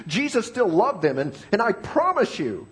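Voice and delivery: usual speaking voice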